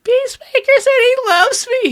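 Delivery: very high, loud kid voice, mock blubbering; bawling little kid. Kid after Peacemaker said he loves him, high mock bawling. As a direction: play a small kid overwhelmed with joy, half crying. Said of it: "just starts bawling".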